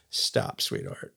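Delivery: whispered, soft and low, calming; gentle hushed stop. Retold or imitated. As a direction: hush her like a father soothing his teen. Father calming upset daughter.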